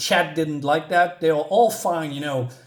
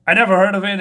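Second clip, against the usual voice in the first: higher, louder protest; retold denial, pitched up and forceful; mock loud denial; 5 semitones higher, 9 dB louder, much faster than usual. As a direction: deny it loudly.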